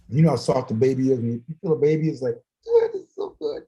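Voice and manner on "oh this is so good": high-pitched coo. Retold or imitated. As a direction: coo like holding a baby. Imagined cooing at baby.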